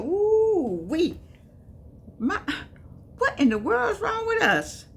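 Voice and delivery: retold shocked exclaim. Speaker retelling seeing Get Out, shocked and high-pitched. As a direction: squeal it in disbelief. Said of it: "I said".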